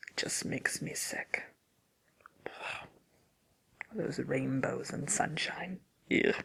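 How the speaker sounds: very quiet, nauseated grumble; disgusted aside. Acted. Witch nauseated by good people.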